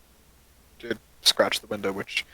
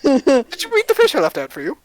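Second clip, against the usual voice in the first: high, louder character voice; suspicious question; 12 semitones higher, 7 dB louder than usual. Acted. Magician questioning the druid about the fish. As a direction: press her on it. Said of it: "he looks at you and goes".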